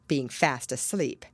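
ordinary delivery

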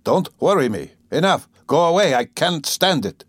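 fed up, pushing them away; irritable. A narrator's reading. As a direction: build from irritation to an outburst. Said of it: "he repeated irritably".

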